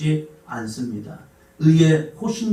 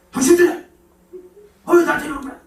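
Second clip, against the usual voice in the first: high, loud mock outburst of an annoyed husband; mock angry husband; 11 semitones higher, 4 dB louder, faster than usual. Husband snapping at his wife over parking, mock angry yell. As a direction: ham up the husband's irritated yell.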